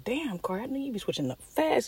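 high far mock voice of followers; high teasing impression of fans. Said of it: "y'all be like".

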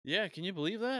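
high, loud imitation of an older gym-goer; mock eager old man, pitch leaping up; older gentleman. Older man at the gym springing a claim on a stranger, imitated eagerly. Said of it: "older gentleman".

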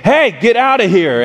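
re-enacted warning yell, short of full volume; retold shout. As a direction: call out as if chasing off a thief. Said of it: "then shout as loud as I can".

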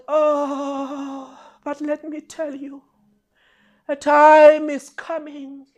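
drawn-out, intoned prophecy with long pauses; solemn foretelling. Acted. Christ warning disciples they will forsake him, solemn and drawn out. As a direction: intone it slowly like a grave prophecy.

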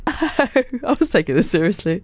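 laughing through the words. Real, not acted. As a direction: giggle while protesting.

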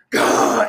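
loud voiceless growling gah; mock attacker's yell. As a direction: snap awake like a movie monster. Man snaps awake like a movie.

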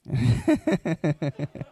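a low chuckle to himself; short laugh. Real, not acted. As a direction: chuckle quietly. Laugh alone.

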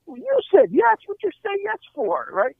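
high, exasperated disbelief; incredulous protest. Retold or imitated. Husband arguing with his wife in the car, incredulous. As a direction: throw it back at her, baffled.

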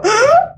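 loud high gasp mimicking her crying family; mock weepy gasp. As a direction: gasp as if overcome by a commercial. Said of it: "ver a mis hermanas y mi mamá así".

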